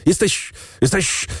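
"Jesteś, jesteś" sputtered high, words stuck in the throat; choking with rage. Acted. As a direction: gag on your own fury. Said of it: "krztusił się w pasji najwyższej".

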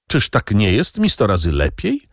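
ordinary delivery

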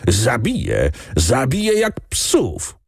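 understated rage, stretching the repeated threat; frenzied shouting. A narrator's reading. Count searching for his gun threatens to kill, frenzied shouting. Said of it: "krzyczał".

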